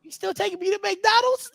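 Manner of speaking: put-on high-pitched little boy voice; son's voice. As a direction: pipe up as a small kid asking dad. Voices his young son.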